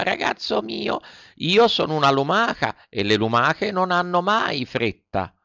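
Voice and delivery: the snail calmly refuses to hurry. A narrator's reading. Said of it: "tutta pace e tutta flemma". (lumaca placida)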